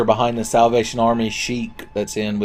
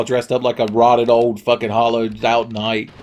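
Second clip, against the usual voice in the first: normal talking voice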